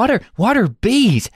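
high-pitched mock confusion; hammed-up puzzled question; mock baffled Yank. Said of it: "the guy's like".

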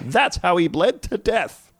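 high-pitched mock eureka announcement; excited discovery. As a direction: imitate a triumphant reveal in a high voice. Acting out Hodgins' excited discovery.

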